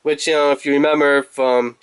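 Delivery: usual speaking voice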